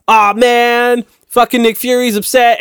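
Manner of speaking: mocking whine. Mocking the show's plot to a co-host, slow whiny mock. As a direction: drag it out like a bored sulk.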